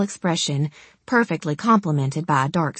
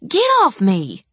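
higher, louder shout to be released; angry shout; 8 semitones higher, 4 dB louder than usual. Acted. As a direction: shout him off you. Struggling against captor.

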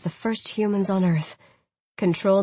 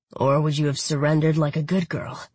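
lightly voiced teasing lover; 2 semitones lower than usual. A narrator's reading. Khan voiced by narrator.

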